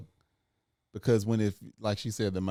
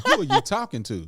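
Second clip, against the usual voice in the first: indignant, louder and higher; stern parental scolding; 12 semitones higher, 8 dB louder than usual. Retold or imitated. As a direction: scold a child in mock outrage. Acting out scolding his son.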